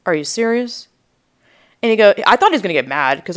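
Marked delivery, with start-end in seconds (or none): 0.1-0.9 imitating a neighbour's sincere disbelief; higher, genuinely questioning; dead serious. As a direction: ask it earnestly, pitched up. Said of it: "'cause she was serious".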